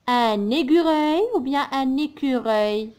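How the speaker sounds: "écureuil" first with a G like "égureuil", then correctly with a K; G then K contrast. Shown as a demonstration. Teacher to pupils contrasting a wrong and a right "écureuil". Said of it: "C'est l'écureuil".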